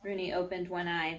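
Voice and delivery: plain reading voice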